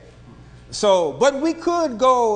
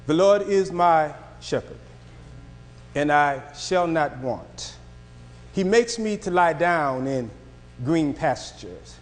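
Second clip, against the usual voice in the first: scripture reading; 6 semitones lower than usual. Acted. Reads Psalm 23 aloud.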